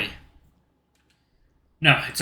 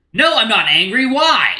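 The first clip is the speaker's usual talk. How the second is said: angry; 4 semitones higher, 5 dB louder, slower than usual. Acted. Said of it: "you kinda sound and act like you're angry".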